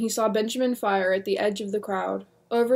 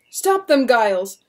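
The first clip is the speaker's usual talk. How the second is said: demanding; 2 semitones higher, 9 dB louder than usual. Acted. William demanding the deputy halt it, loud demand. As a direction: order him to stop it. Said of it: "William demanded".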